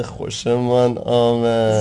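drawn-out, very slow mock approval; slow mock-formal. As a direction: savour each word like a pompous lord. Mock formal pleased remark. (آهسته و کشیده با لحن رسمی شوخی)

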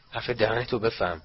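ordinary delivery, nothing marked

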